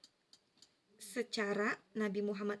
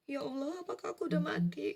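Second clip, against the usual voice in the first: higher, pleading voice close to tears; frightened plea to God, higher and teary; tearful plea; 5 semitones higher than usual. Retold or imitated. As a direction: beg God as if about to cry. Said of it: "Sampai aku nangis-nangis".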